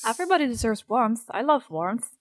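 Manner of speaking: usual speaking voice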